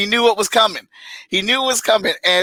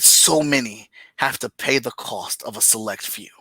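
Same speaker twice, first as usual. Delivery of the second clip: dramatic reading voice; 3 semitones lower than usual. Shown as a demonstration. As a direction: read the panel like an epic trailer. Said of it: "in my best reading voice as I can possibly muster".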